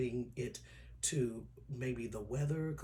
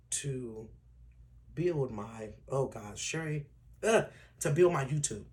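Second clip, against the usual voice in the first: louder, strained voice near tears; fighting back tears; 7 dB louder than usual. Real, not acted. Vlogger to followers, close to crying. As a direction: push through as if about to cry. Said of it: "I'm trying not to cry".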